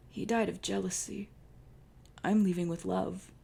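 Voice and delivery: lightly voiced wounded lover; tender dying lover. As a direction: comfort him with your last breath.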